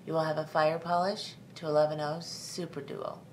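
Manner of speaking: normal talking voice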